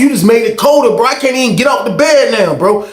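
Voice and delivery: high, exasperated complaint; protesting. Retold or imitated. Inmate stuck on cold bed, high protest.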